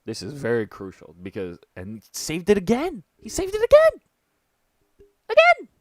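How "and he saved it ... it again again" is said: rising excited shout; getting louder and higher with excitement. Real, not acted. Excited reaction to saved point.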